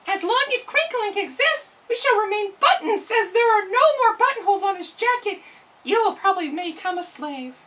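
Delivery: high, pinched voice of a captive button; a fearful little prisoner, pitched high; enslaved button voice. Acted. Buttons speak of Crinklink.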